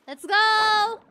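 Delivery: loud, high celebratory shout; triumphant cheer. Real, not acted. Player whose cat finally ate, triumphant cheer. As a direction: cheer it out, stretching the end.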